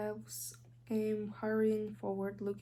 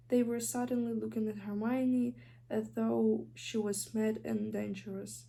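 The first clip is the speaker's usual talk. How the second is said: usual speaking voice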